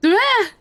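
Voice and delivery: high startled cry, loud; startled yelp. Acted. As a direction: jump with fright. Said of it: "it's a startled noise".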